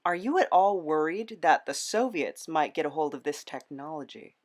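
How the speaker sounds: ordinary delivery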